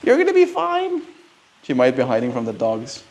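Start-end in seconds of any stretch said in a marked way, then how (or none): 0.0-1.0 very high sweet pet voice; pet talk. Real, not acted. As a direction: coo to the kitty up high. Greeting the cat.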